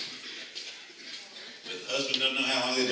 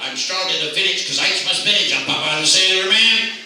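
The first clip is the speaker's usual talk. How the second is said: belted out higher and louder; a big loud Popeye chant; loud shout; 7 semitones higher, 7 dB louder than usual. Shown as a demonstration. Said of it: "in your loudest voice and shout out".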